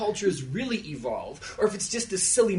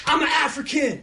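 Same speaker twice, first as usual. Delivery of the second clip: high shouted lead call; call to the crowd; 8 semitones higher, 4 dB louder than usual. Shown as a demonstration. Speaker leading the audience chant, shouted call. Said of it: "we'll do this one more time".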